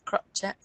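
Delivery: "crochet" as CROTCH-et, final t sounded; friend's Geordie pronunciation. Shown as a demonstration. Presenter repeating the friend's word for it, "crochet" as CROTCH-et. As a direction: rhyme "crochet" with "botch it". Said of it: "Geordie calls it".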